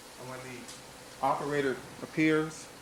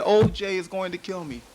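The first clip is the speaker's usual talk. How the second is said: louder, higher pleading outburst; retold cry; 6 semitones higher, 5 dB louder than usual. Prosecutor voices wife answering the officer, retold cry. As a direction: hit the name loud and high, then keep it urgent.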